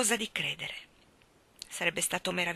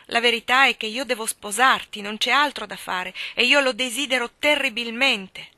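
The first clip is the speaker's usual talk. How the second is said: louder, pressing declaration; insistent declaration; 4 semitones higher, 6 dB louder than usual. A narrator's reading. Mark tells her he must marry her, louder and insistent.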